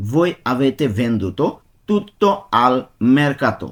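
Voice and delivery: slow, careful Italian, word by word; slow demonstration. Shown as a demonstration. Teacher to Bengali students learning Italian, slow and careful. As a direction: spell it out slowly for learners. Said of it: "teacher demonstrating an Italian sentence".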